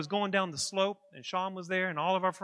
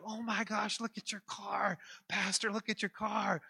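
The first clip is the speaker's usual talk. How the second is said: mock alarm; 2 semitones higher, 4 dB quieter than usual. Nurses exclaim at wrecked car.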